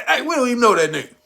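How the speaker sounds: ordinary delivery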